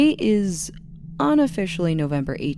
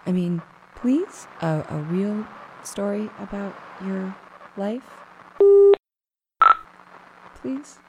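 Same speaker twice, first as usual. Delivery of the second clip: pleading; 3 semitones higher, 4 dB louder than usual. Acted. Pleading with friend for story.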